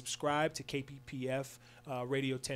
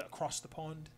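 lightly put-on British accent; a weak mock British accent, thrown in softly; terrible British accent; 9 dB quieter than usual. Radio host introducing the overseas game, terrible British accent. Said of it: "that was a terrible accent".

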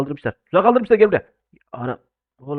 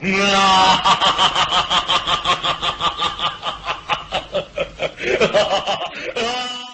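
villain laugh; 3 semitones higher than usual. Acted.

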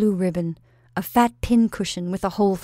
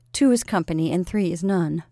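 ordinary delivery

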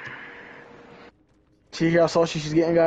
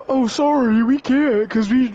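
high, loud whiny-clerk imitation; exaggerated high-pitched mocking apology; mock fast-food worker; 10 semitones higher, 5 dB louder, slower than usual. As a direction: mimic a useless drive-thru worker, dragging the end. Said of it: "fast food places like".

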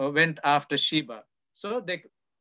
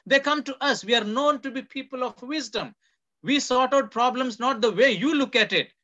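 indignant rebuke; 5 semitones higher, 5 dB louder than usual. Retold or imitated. Woman rebuking Joab voiced in sermon.

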